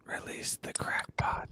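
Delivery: slow, soft unvoiced whisper. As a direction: lean in and whisper it. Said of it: "in my whisper voice".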